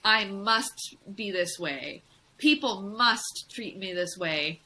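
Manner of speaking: slow, with weight on "must"; demanding inner voice. Shown as a demonstration. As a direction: spell out the demand, leaning on "must". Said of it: "that's your internal voice saying that".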